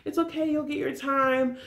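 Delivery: gently pat her on the head; mock sympathetic girls.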